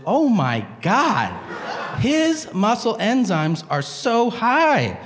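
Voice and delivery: high, drawn-out astonishment; amazed nurse. Retold or imitated. Nurse reading patient's chart to the doctor, amazed. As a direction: stretch it out high, hammed-up amazement.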